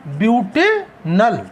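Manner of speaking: Butanal drawn out, paused before नल; slow dictation. Shown as a demonstration. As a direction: split butanal slowly into ब्यूटे and नल. Teacher repeats name in parts.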